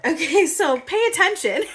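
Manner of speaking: high, loud giggling; laughing. Real, not acted. Still tickled, reading the card while giggling. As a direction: laugh through the words. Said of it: "I'm sorry I'm reacting like this".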